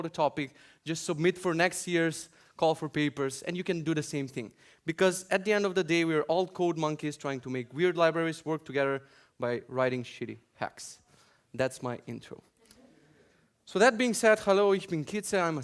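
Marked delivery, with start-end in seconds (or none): none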